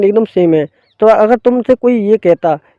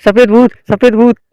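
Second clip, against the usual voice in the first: frightened shout, higher and louder; scared yell; 5 semitones higher, 4 dB louder than usual. Acted. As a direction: cry out in terror at the ghosts. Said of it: "देख कर डर जाता है".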